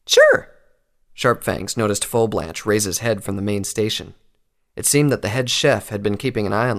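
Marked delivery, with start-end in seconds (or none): 0.0-0.5 much higher, louder, bright; eager high chirp. Acted. Pinkie eager reply.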